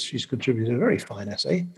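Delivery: ordinary talk, nothing marked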